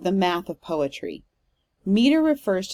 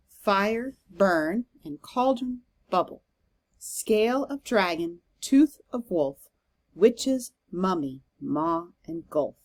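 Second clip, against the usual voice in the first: measured verse beat, first syllable stressed in "cauldron", "dragon", "witches"; trochaic stress. Shown as a demonstration. Teacher pronounces the witches' verse for the class, trochaic stress. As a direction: lean on "fire", "burn", "scale", "tooth", letting each following syllable fall. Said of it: "I'm gonna pronounce it".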